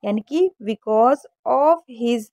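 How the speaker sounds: because stretched slowly, the se sounded as ज; slow teaching demonstration; slow model reading. Shown as a demonstration. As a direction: draw out because of his slowly. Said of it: "एसई को हमेशा जा पढ़िए".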